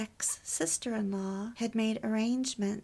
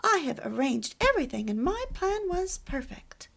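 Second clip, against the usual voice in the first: higher, louder imitation of her indignation; self-righteous; 6 semitones higher, 6 dB louder than usual. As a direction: mimic someone insisting on her own plan, emphatically. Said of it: "she was in-, intimating".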